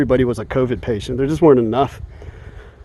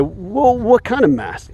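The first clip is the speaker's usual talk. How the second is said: higher, innocent questioning voice; mock-naive question to officials; 8 semitones higher, slower than usual. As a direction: play dumb asking which mask. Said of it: "ask them and say".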